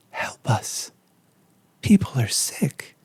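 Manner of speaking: hushed, breathy plea, slow; eerie whisper. Retold or imitated. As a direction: whisper it like a ghost begging. Third stranger in nightmare.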